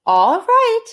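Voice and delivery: high, loud agreeable; Amelia Bedelia cheerful. Acted. Amelia Bedelia agreeing, high and bright. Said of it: "said Amelia Bedelia".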